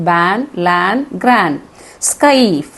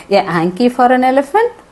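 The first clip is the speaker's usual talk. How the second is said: higher-pitched disbelieving question; surprised; 5 semitones higher, much slower than usual. Acted. Sales girl asking about an elephant's hanky, surprised. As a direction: question it in surprise, rising at the end. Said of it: "The sales girl was surprised".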